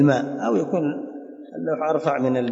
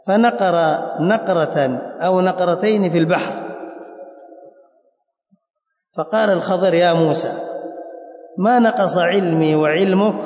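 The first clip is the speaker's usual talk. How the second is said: raised, deliberate reading with pauses between phrases; formal narrative reading; 5 semitones higher than usual. Acted. Teacher reading al-Khidr's words to Moses, formal reading. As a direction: proclaim the text phrase by phrase. Reads the story text aloud.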